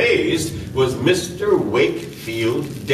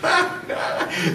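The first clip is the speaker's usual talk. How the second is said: gloating laugh; 13 semitones higher, 4 dB quieter than usual. Acted. Chief gloats at the escape.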